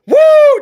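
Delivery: very high loud whoop; excited woo. Real, not acted. Excited about the name.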